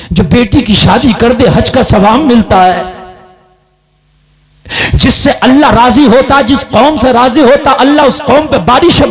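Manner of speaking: loud preaching, pitch rising on Beti; full-voiced sermon climax; fiery preaching. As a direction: preach it out to the crowd.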